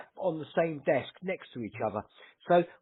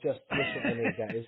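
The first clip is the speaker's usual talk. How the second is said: laughing; 9 semitones higher, 3 dB quieter than usual. Real, not acted. Wry joke with laugh.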